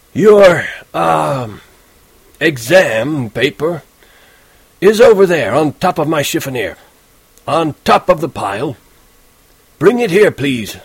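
put-on old teacher grilling a lazy student; stern old teacher. A narrator's reading. Teacher Spencer demanding the truth from Holden, stern.

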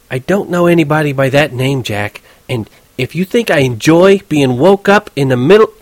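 indignant tigress over the phone; near yelling. A narrator's reading. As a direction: scold the caller until cut off. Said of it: "She was damn near yelling".

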